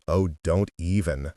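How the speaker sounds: dismissive voice for Dory; scoffing friend. A narrator's reading. Friend scoffing at gloomy girl, dismissive. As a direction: brush off the excuse.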